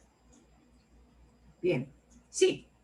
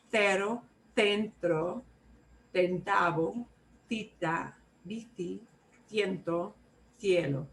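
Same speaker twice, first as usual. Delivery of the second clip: Spain accent. Shown as a demonstration. Teacher showing students the Spain pronunciation, Castilian accent. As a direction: say the words with a Spain-style th for c. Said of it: "here's how all those words sound if you're in Spain".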